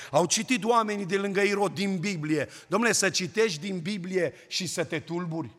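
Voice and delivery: high, loud sermon voice pressing each line; preaching at full force. Acted. Preacher to the congregation, fervent loud preaching. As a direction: preach it out to the church.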